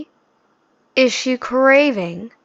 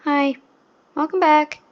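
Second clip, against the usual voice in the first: mock half-hearted greeting, slightly higher pitch; unexcited greeting; 4 semitones higher than usual. YouTuber mocks a vegan host's intro, half-hearted imitation. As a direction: imitate a host barely trying to be excited. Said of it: "not even trying anymore to be excited".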